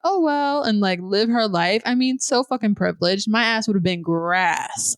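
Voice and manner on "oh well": put-on high, breezy oh well like a spoiled kid; carefree shrug. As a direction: shrug it off without a care. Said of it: "and be like".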